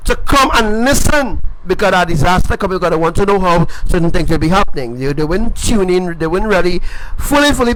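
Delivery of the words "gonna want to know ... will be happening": ordinary talk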